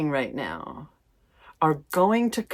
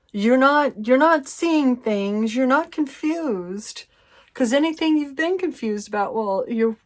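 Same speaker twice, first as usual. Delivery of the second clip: emphatic reassurance; 8 semitones higher, 4 dB louder than usual. Real, not acted.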